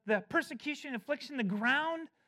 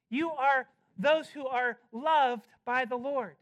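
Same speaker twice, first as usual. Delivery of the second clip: pressing "don't" with building force; emphatic preacher plea. Acted. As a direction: urge them not to doubt. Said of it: "he wants to say emphatically".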